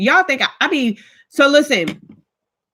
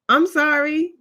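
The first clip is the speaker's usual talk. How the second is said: higher-pitched, mildly pleading apology; apologetic; 6 semitones higher, slower than usual. Retold or imitated. Apologizing for her ignorant question, lightly acted apology. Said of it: "I was really apologetic though".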